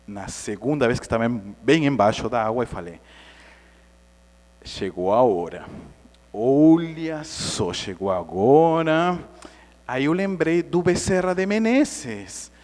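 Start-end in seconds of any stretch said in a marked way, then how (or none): 4.6-9.2 slow, portentous announcement; drawn-out doomed pronouncement; solemn resignation, acted. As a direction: announce your own death with mock gravity. Solemn underwater thought of death.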